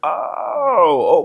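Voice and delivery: loud, high, drawn-out "Oh"; realization. Retold or imitated. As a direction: stretch it out as the penny drops. Sudden realization after looking it up.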